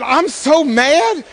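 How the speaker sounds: slow, drawn-out mock pout; mock mad wife. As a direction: stretch it out like a sulking wife. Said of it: "And my wife was mad".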